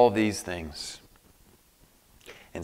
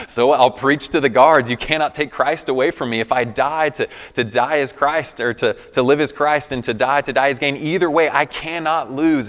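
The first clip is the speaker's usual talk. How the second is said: higher, louder, defiant proclamation; Paul in prison; 4 semitones higher, 8 dB louder than usual. Retold or imitated. Paul in prison preaching to the guards, defiant and louder. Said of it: "it's like".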